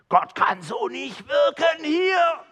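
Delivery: high, slow shouted complaint; hammed-up outraged shouting; agitated church elder. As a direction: shout it drawn out at a strained high pitch. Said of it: "der hat sich sehr aufgeregt".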